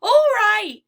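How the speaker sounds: bright, loud chime-in; chiming in. A narrator's reading. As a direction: jump in eagerly. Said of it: "he chimed in".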